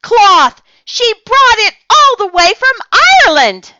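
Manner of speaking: put-on very high, loud outraged girl; girl scolding her friend about the tablecloth; scandalized shriek. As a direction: shriek at the stain.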